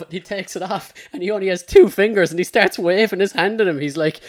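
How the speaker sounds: usual speaking voice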